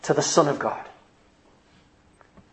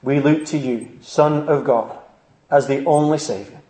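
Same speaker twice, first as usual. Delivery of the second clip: prayer; 5 semitones lower than usual. Real, not acted. Leads closing prayer.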